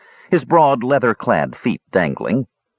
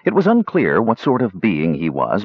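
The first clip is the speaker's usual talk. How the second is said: ordinary delivery, nothing marked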